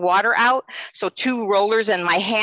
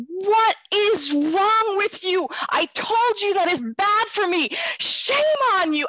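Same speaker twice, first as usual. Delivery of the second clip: much higher, slow, tearful angry scolding; angry crying scold; 13 semitones higher, slower than usual. Retold or imitated. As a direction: scold the doctor through tears, pitched high. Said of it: "I was so angry and I was crying".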